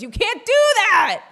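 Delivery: high, loud, hammed-up yell of protest; mock outraged shout. Coffee shop owner Jesse catching the barista, in a hammed-up shout. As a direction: bellow it like a scandalized boss. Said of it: "he shows up and goes".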